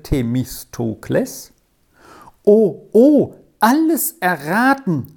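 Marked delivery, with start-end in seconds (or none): 2.4-5.1 high, louder cry of delight; overjoyed exclamation. A narrator's reading. Rolf learns both riddles are guessed, overjoyed. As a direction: burst out thrilled at the answer. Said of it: "rief Rolf hocherfreut".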